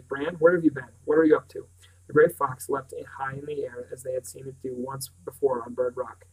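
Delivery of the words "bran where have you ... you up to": louder, sharp demand. A narrator's reading. Owen Davies sharply questioning Bran, loud and sharp. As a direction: snap the questions at the boy. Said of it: "Owen Davies said sharply".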